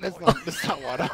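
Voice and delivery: high, giggly protest; words squeezed through real laughter; laughing. Real, not acted. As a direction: break up laughing at your own bad impression. Cracking up at own failed impression.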